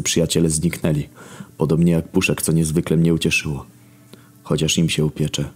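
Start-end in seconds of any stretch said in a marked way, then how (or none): none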